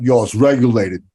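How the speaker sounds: brash New York street guy; New York accent. Pretend. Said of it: "That's my New York accent was coming out".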